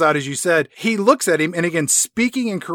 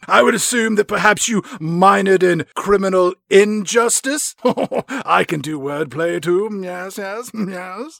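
slow, hammed-up British spy; James Bond; slower than usual. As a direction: savour it as a mock-suave British agent. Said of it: "Bond is like".

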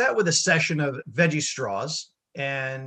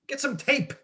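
exasperated order; 10 semitones higher, 3 dB quieter than usual. Retold or imitated. End of acted rant at kids.

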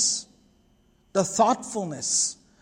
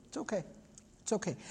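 very soft, soothing aside; gentle reassurance; 12 dB quieter, faster than usual. Retold or imitated. Prophet letting her vent, softly voiced reassurance. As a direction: let it go by softly, imitating calm forbearance.

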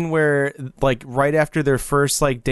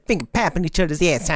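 imitating Corey; 4 semitones higher, faster than usual. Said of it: "Now just picture Corey saying".

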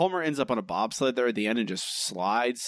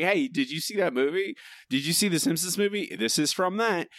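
mock TV writer; 6 semitones higher than usual. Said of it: "It's just like".

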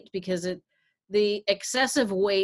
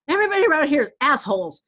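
fast, loud, higher angry outburst; angry mom; 7 semitones higher, 7 dB louder, much faster than usual. Retold or imitated. Mom lashes out at the nurses, loud and fast. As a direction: snap it out fast like a cranky old mom. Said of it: "my mom's like".

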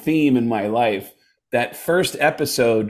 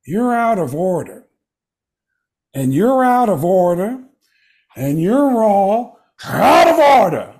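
high impassioned outburst, building to a drawn-out roar; Al Pacino; 8 semitones higher, 5 dB louder than usual. Retold or imitated. Slumlord laundromat tenant to broken washers, Al Pacino impression. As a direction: escalate each line into a courtroom-style shout. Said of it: "cut to me as Al Pacino".

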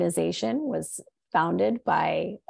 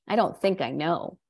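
ordinary talk

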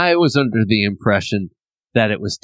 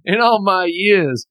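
higher, slow mock Southern lawyer drawl; 9 semitones higher than usual. Host joking along about chatoyancy, mock Southern lawyer. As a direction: play a Southern lawyer, pitched up and drawn out. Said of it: "like a smart Southern lawyer".

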